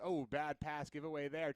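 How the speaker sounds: ordinary delivery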